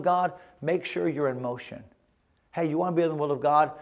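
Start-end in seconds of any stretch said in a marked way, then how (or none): none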